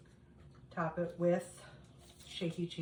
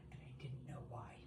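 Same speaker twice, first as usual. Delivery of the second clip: whispered aside; 4 semitones lower, 14 dB quieter than usual. Real, not acted. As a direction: let it slip out as a secret whisper. Confiding aside to viewers.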